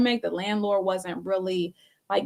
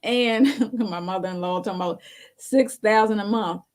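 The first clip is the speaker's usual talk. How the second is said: ordinary talk, nothing marked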